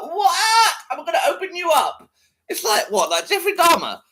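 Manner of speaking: high, loud disbelieving "what"; incredulous high laugh-talk. Real, not acted.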